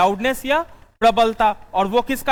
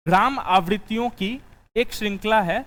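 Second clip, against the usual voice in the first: dictating notes. Acted.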